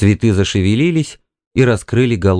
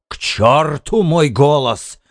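slow, higher-pitched furious retort; angry; 5 semitones higher, slower than usual. A narrator's reading. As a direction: draw the curse out in anger. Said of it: "Он в гневе наклонился". (гневно, с растяжкой)

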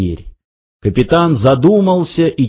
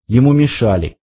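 ordinary delivery, nothing marked

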